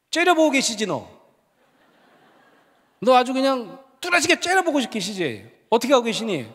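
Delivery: re-enacted professor cornering a student; pressing accusation. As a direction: press the point insistently. Presses student about a glaring God.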